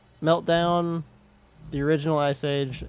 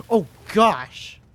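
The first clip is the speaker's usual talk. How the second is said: surprised gasp; 6 semitones higher, 3 dB louder than usual. Real, not acted. Sudden realization, gasps.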